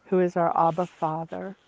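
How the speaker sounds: ordinary delivery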